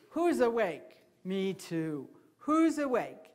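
hooting rhythm, dropping low on the answer; great horned owl words. Shown as a demonstration. Handler teaching kids the great horned owl's call, hooted words. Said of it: "and she says".

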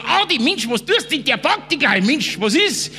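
high-pitched mock alarm; urgent warning. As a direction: warn a friend urgently, imitating the moment. Acted urgent warning to Adi.

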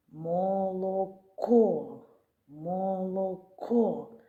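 "Молоко" stretched very slowly, leaning on the к; slow sounding out. Shown as a demonstration. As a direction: draw out "Молоко" to hear the к. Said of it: "Звук «к»". (дуже повільно, по звуках)

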